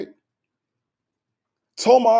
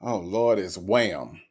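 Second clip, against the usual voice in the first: dreading son; 10 semitones lower, 5 dB quieter, slower than usual. Acted. Son seeing mother calling.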